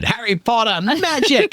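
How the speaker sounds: high, excited mock commentator call; a touch of a hyped rugby commentator; imitating the commentator. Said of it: "one of the commentators said".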